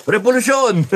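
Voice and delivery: a sudden high, loud cry of revolusyon; half-joking rally shout of revolusyon; mock shout. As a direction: belt out the word like a rally chant. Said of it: "Baka sisigaw ko".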